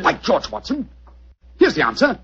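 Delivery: triumphant exclamation; excited discovery. Acted. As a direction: burst out with the find. Holmes makes a sudden discovery.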